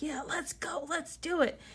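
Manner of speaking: high, light self-cheer; happy pep talk. Retold or imitated. Planner cheering herself on from fitness quotes, happy and high. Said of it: "like, all happy and like".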